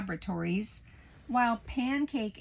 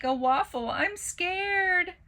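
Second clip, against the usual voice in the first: put-on high frightened child voice; scared; 10 semitones higher, 4 dB louder, slower than usual. Child Pancake frightened of the waffle. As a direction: whimper it in fear.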